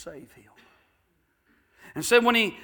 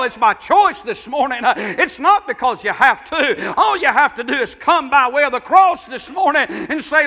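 full-force preaching; 9 semitones higher, 12 dB louder than usual. Real, not acted. Preacher telling sinners to come by way of the cross, preaching at full force. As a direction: preach it at full voice, pressing every phrase. Preacher presses the altar appeal.

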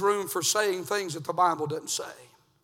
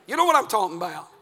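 high, loud, driving sermon; shouting revival preacher; full-force preaching; 10 semitones higher, 12 dB louder than usual. Acted. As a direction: hammer it home to the parents.

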